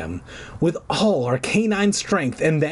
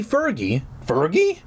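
ordinary delivery, nothing marked

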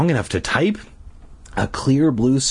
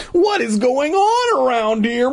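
high, slow disbelief; shocked; slower than usual. A narrator's reading. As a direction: cry out in disbelief. Bee stunned by betrayal.

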